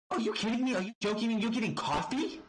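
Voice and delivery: high, outraged retelling of a furious wrestler; mock outrage, voice pitched high; incensed disbelief. Peyton Royce mocking Alexa over the coffee, high incredulous outrage. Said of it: "can verbally be heard saying".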